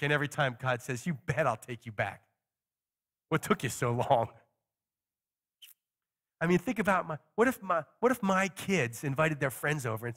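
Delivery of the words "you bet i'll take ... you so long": welcoming father. Acted. God welcoming back the sinner.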